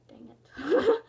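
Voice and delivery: unvoiced whispered curse; whispered dang it. Caught by Sierra, whispered curse. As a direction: hiss it under your breath. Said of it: "I'm just like".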